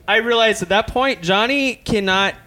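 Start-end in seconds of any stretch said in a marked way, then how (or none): none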